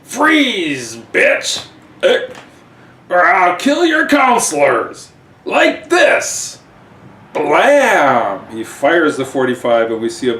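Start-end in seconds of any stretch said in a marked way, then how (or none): 0.0-6.6 put-on high, loud drunken bellow; drunk dwarf threat. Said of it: "And he says". 7.3-8.5 gunshot blam. Acted. Gunshot sound effect.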